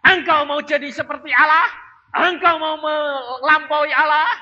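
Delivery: a hint of a stern rebuke; voice of Jesus. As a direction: raise it, questioning sharply. Sermon voicing Jesus's rebuke.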